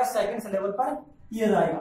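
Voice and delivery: usual speaking voice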